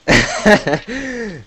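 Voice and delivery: high giggling laugh; burst of laughter. Real, not acted. Laughing at own joke.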